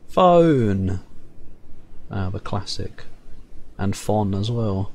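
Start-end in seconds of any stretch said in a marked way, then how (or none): 0.1-1.0 calling out. Acted. Calling out chat name.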